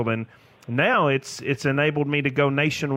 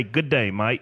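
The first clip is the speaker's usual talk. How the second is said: Australian accent attempt; 4 semitones lower than usual. Shown as a demonstration. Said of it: "hard for a, uh, Tennessee redneck to say".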